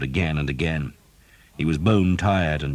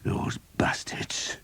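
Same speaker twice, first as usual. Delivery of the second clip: slow, whispered curse; vengeful whisper; 5 dB quieter, much slower than usual. Acted. Skeet cursing arriving men-at-arms, slow vengeful whisper. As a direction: hiss it with resentment. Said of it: "he said vengefully".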